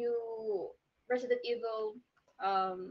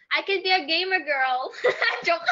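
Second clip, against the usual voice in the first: laughing joke; 6 semitones higher, 5 dB louder than usual. Real, not acted. Joking boast, laughing.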